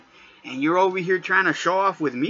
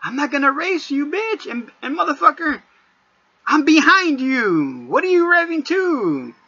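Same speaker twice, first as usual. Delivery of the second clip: fed-up yell at the driver ahead; exasperated yell; 9 semitones higher than usual. Retold or imitated. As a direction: yell at the revving fool. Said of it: "I'm like".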